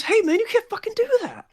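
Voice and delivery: very high, indignant character voice; alarmed, angry protest. Acted. Nurse grabbing an intruder, angry protest.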